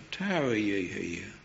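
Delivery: "Tarry ye here" said low, soft and hushed; hushed, whisper-like. Shown as a demonstration. The Lord speaking to each soul, hushed and low. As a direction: let it fall like a gentle inner whisper. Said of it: "may the Lord whisper that".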